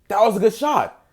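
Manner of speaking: usual speaking voice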